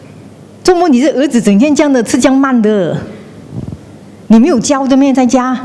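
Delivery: 责怪的语气，音调偏高; nagging criticism. Retold or imitated. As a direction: imitate a relative blaming the parent. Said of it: "Now, when they said".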